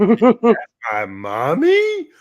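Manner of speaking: high, very slow, stretched dog voice. Retold or imitated. Owner voicing his pug hearing mommy, high stretched. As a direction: let the pug gasp in disbelief. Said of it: "Bear's like".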